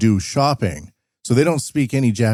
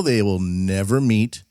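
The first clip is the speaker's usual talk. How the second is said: ordinary delivery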